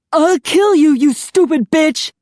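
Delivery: very loud, high murderous yell; husband yelling threats at his wife. A narrator's reading. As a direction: threaten to kill her. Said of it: "Charlie yelled".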